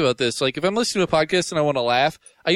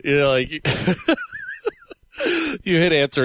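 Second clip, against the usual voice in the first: ordinary talk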